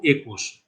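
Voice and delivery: একুশ slowly, starting on pure "e"; teacher's slow একুশ with closed এ; closed-vowel pronunciation. Shown as a demonstration. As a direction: model একুশ as a careful "e-kush". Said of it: "এ দিয়ে বলবো".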